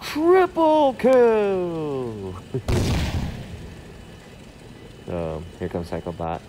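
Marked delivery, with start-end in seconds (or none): 0.0-2.3 very high, slow stretched announcer yell; game announcer callout. Retold or imitated. Echoes the game's kill callout. 2.4-5.0 soft unvoiced chuckle; breathy laugh. Real, not acted. Laughs after the triple kill.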